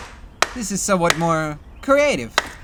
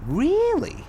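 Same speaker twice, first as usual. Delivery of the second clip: Really? swooping up high, falsely amazed; mock surprise; 13 semitones higher than usual. Captive taunting his killers with mock amazement. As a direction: feign delight at the news. Mock interest in train death.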